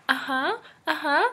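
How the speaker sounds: high, rising little uh-huhs; impersonating a friend's high-pitched uh-huh; Dakota. Retold or imitated. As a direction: chime it in high, lifting each one at the end. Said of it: "That's my perfect Dakota impersonation".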